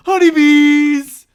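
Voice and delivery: high, full-volume drawn-out shout on Honeybees; gleefully over-the-top high yell; shouting like a moron. Acted. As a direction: yell the shop name like an overcaffeinated barista. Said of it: "can't say the name without fucking shouting it".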